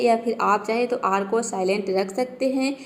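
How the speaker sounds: ordinary talk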